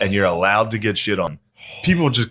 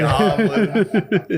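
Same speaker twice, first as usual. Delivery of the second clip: laughing; 10 semitones higher than usual. Real, not acted. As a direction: laugh the word out high. Laughing at the pun.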